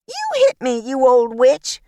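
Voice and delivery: high, loud outraged shriek; Violet shrieking. A narrator's reading. Violet shrieks at Agnes after being punched, high and loud.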